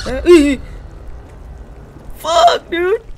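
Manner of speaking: high, loud panicked yelp; genuinely spooked shriek; startled scream. Real, not acted. Monster appears suddenly.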